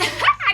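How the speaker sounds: a sharp high burst of laughter. Retold or imitated. Imitating her friends mocking her, a high laugh. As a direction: squeal out a laugh.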